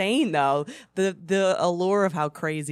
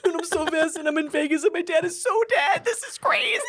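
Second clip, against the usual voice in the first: very high hysterical wail, played for laughs; hammed-up screech into the phone; mock screaming; 12 semitones higher than usual. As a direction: imitate a high-pitched meltdown, climbing to the end. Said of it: "just, like, screaming".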